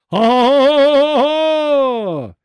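long, drawn-out high roar of ha ha; roaring mock laugh. A narrator's reading. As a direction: bellow each ha slowly at full force like a prank. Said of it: "az állati üvöltés".

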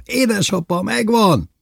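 high excited call to dad; triumphant yell. A narrator's reading. Boy telling his father about the catch, high excited yell. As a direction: cry out the news, high and stretched. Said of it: "ordítottam".

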